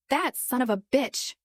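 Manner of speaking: lightly voiced Brooklyn growl; Brooklyn accent. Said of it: "she growled".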